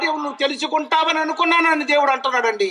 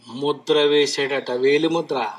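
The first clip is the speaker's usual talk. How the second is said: low God voice; 11 semitones lower, slower than usual. Retold or imitated. Preacher voices God speaking.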